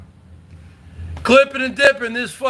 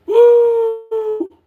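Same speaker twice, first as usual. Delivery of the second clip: whoop; 10 semitones higher than usual. Real, not acted. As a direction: let out a big cheering woo. Streamer whooping at a donation.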